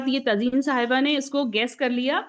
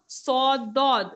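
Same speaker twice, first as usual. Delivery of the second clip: सौ दौद stretched very slowly; slow model; much slower than usual. Shown as a demonstration. Teacher models slowly for the class to repeat. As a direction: draw out each letter name. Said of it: "साथ साथ बोलिए".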